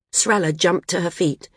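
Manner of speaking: ordinary delivery, nothing marked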